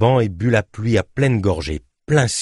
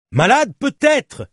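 high, slow, raised voice; defiant retort; 4 dB louder, slower than usual. Acted. Journalist confirming to his furious companion, defiant shout. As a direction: concede it loudly, with more to come.

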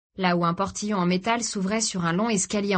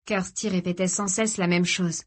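ordinary delivery

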